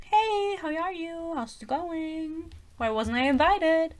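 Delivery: high, soft, drawn-out hello; sing-song it like an uninvited guest being coy; mock breezy greeting. Voicing Rhys crashing the wedding.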